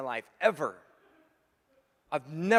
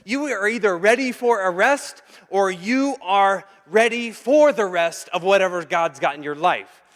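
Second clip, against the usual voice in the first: higher, louder preaching build; fervent preaching; 5 semitones higher, 11 dB louder than usual. Acted. Preacher telling the congregation winning matters, full force. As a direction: drive the point home from the pulpit.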